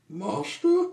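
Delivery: very high, loud squeal; incredulous question. Acted. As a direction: shriek the word back as a question. Balks at having to say master.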